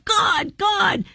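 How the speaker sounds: re-enacting a child's anger at God, high-pitched; angry twelve-year-old. Recalling herself at twelve railing at God, imitated high, angry protest. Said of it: "He can take our angry fists at twelve".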